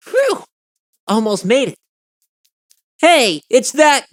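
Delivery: hammed-up excitement. Starts acting out a scene.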